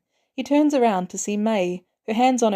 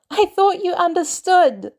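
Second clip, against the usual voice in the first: hurt; 11 semitones higher, 5 dB louder than usual. Acted. Peter betrayed, upset.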